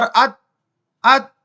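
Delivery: अत cut dead on the त, no echo vowel; clean stop. Shown as a demonstration. Teacher showing where the sound halts, clean stop on अत. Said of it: "रुक गई कहानी".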